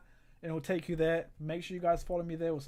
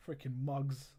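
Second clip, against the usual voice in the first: ordinary delivery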